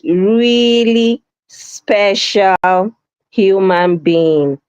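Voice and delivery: REALLY SPECIAL stretched very long and slow; emphasis on really special. Shown as a demonstration. Host relishing a viewer's comment, heavy emphasis on "really special". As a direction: draw out "really" and "special", savouring each word. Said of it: "I like the emphasis".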